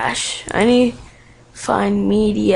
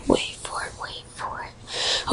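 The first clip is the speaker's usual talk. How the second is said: whispering; 12 dB quieter than usual. Real, not acted. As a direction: breathe it softly, waiting. Suspense waiting for popup.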